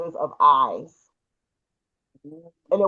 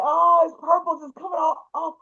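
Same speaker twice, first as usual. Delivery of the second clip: high, wonderstruck retelling; excited amazement; 9 semitones higher than usual. Retold or imitated. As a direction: re-enact gushing over an amazing sight. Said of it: "You know, I was just so excited".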